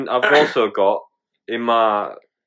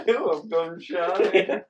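higher, drawn-out mocking echo; teasing mimicry; 5 semitones higher, 5 dB quieter, much slower than usual. Teasing a co-host who froze before the intro, mock-shy mimicry. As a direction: draw it out slowly, mimicking them.

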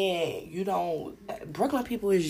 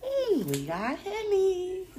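higher, slow, put-on sly voice; teasing offer; 14 semitones higher, slower than usual. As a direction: dangle the Henny temptingly. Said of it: "So they was like".

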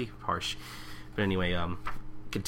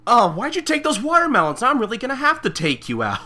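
much higher, louder, laughing mock swordsman; 8 dB louder than usual. Friend mocks the swordsman, high laughing parody. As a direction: ham up the chasing swordsman. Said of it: "He's just like".

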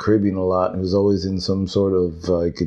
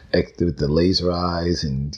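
ordinary delivery

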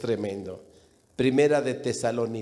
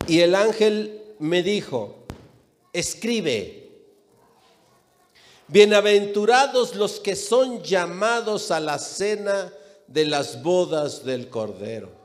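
forceful, measured pulpit reading; scripture declamation; 7 semitones higher, 5 dB louder than usual. Acted. Preacher reading the angel's words to John, declamatory. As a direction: proclaim the verse to the whole congregation.

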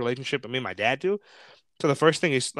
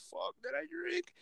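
very high, hushed self-questioning; worried inner thought; 13 semitones higher, 13 dB quieter than usual. Retold or imitated. Unsure before the breathalyzer, worried inner thought. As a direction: squeak it quietly to yourself.

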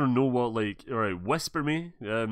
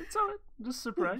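falsetto. Pretend. Repeated reassurance, very high.

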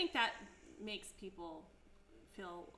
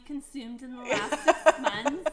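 loud, slow laughing question; laughing mock question; 3 semitones higher, 18 dB louder than usual. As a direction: read it like a form through laughs.

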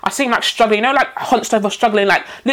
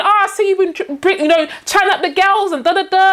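higher, playful mock scolding from the carer; teasing carer voice; 7 semitones higher than usual. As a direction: tease him like a fond carer. Key worker teasing, voiced.

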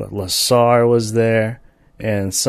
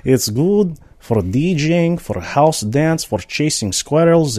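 slow, higher mock Russian accent; 6 semitones higher, 4 dB louder, slower than usual. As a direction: drawl it like a fake Russian. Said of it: "doing Russian accents".

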